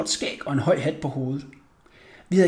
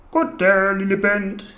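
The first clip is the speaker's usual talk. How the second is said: child's put-on man's voice; deepest voice; 3 semitones higher, 3 dB louder, slower than usual. A narrator's reading. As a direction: play the grown man greeting a small boy. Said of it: "sagde jeg med den dybeste stemme".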